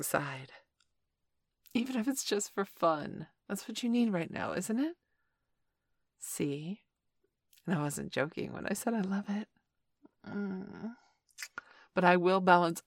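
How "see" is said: whisper. Acted. Aside to partner.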